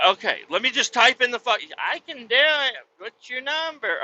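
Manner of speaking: high, pushy cashier; mock cashier asking his number. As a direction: squeak it up like the cashier. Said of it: "I'm like".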